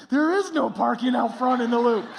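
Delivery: loud, high exasperated protest; comically frantic objection; stressed out. Retold or imitated. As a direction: insist it's impossible, all worked up. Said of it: "I'm getting all stressed out".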